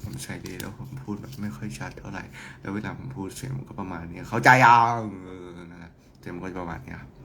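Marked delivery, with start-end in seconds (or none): 0.0-3.5 soft, low-key everyday speaking voice; understated, not very clear speech; normal unclear voice. Shown as a demonstration. 3.6-4.2 soft, low demonstration of own voice; understated and low-key; normal speaking voice. Shown as a demonstration.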